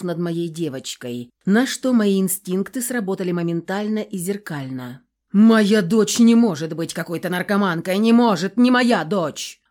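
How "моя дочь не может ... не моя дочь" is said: furious shout. A narrator's reading. Father refusing to believe his daughter is an addict, furious shout. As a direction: shout down the very idea, hammering each denial.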